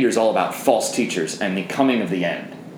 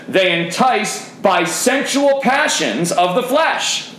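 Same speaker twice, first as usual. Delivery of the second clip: slow, higher, weighty delivery ending up on "flesh"; emphatic reading; 8 semitones higher, slower than usual. Shown as a demonstration. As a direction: stretch out "sensual passions of the flesh". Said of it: "I'll try and read emphatically".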